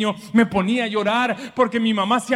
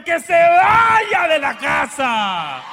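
emphatic shout; 4 semitones higher, 5 dB louder, much slower than usual. Real, not acted. Preacher's emphatic verdict.

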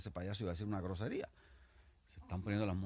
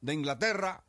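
pompous officer; 5 semitones higher, 3 dB louder than usual. Acted. Officer ordering the English soldier to jump, declaiming. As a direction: proclaim it like a grand commander.

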